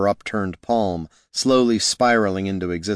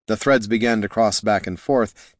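ordinary delivery

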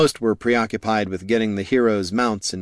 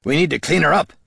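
gruff dwarf voice; 7 semitones higher, 4 dB louder, faster than usual. Acted. Gorm instructs goblin.

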